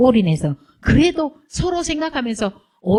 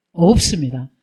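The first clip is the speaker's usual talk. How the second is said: ordinary talk, nothing marked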